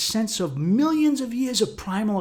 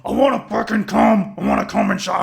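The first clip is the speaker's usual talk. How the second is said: louder, higher primal voice; primal lizard brain; 5 semitones higher, 7 dB louder than usual. Retold or imitated. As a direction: shout it like raw instinct. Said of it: "my primal lizard brain got a voice".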